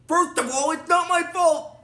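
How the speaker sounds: high, defensive goofy villain voice; Rito. Retold or imitated. Imitating a startled villain denying blame, defensive. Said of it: "by that he's like".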